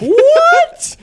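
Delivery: very high, loud squeak of disbelief; a stunned shriek; shocked what. Retold or imitated. Imitating the viewer stunned by the plot twist, high squeak. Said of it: "And you're like".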